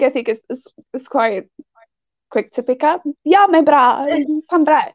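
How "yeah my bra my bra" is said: Cape Town accent. Shown as a demonstration. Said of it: "So a Cape Tonian thing".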